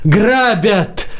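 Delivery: high, urgent cry of robbery; straining shout. A narrator's reading. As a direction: pitch it up as if yelling hard. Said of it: "закричал Варан, что из силы".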